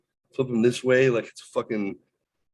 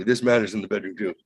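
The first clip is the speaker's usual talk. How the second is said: ordinary talk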